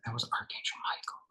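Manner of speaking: hushed, soft whispered reveal; awed whisper. Retold or imitated. Telling who the stranger was, awed whisper. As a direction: whisper the name as a revelation.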